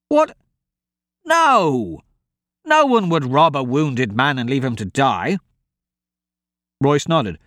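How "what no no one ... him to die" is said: appalled disbelief. Acted. Decent man answers his callous partner, appalled. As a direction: exclaim in shock, then protest.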